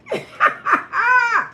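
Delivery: high, shouted cackle; loud burst of laughter. Real, not acted. Teller cracking up at her own story, loud high cackle. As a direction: howl with laughter. Laughing at hanky-panky line.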